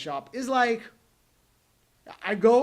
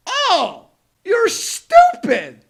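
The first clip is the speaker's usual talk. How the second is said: mock salesman; 11 semitones higher, 12 dB louder, much slower than usual. Mocking the salesman's inner thoughts.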